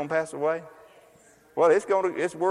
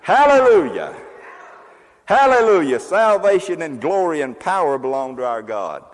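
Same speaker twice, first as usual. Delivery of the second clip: multitude shouting; 3 semitones higher, 8 dB louder than usual. Acted. Preacher voicing heaven's multitude at Babylon's fall, shouting. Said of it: "roar of a great multitude in heaven shouting".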